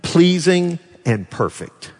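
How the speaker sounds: slow, deliberate reading, each word set apart; slow scripture reading. Shown as a demonstration. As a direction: read it out word by word. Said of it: "In Romans twelve two, it says".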